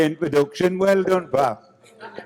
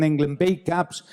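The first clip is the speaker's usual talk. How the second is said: ordinary talk, nothing marked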